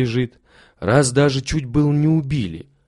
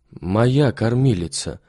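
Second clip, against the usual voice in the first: plain reading voice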